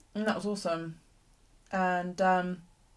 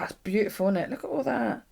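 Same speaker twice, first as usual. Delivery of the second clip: normal talking voice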